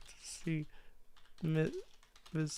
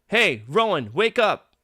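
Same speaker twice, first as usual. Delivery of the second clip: calling out; 4 semitones higher, 8 dB louder, slower than usual. Acted. Waking a sleeping friend.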